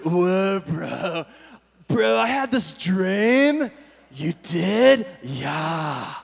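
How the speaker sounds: slow, dazed stoner drawl; stoner talk. Shown as a demonstration. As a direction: play it like a stoned buddy. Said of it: "it sounds like stoner talk".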